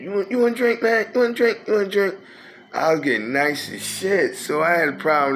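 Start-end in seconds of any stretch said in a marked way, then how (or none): 0.0-2.1 much higher, eager, pushing drinks on everyone; tipsy generous host voice, pitched way up; buying a round for the whole bar, excited and high; excited offer of drinks. Retold or imitated. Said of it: "I was buying every".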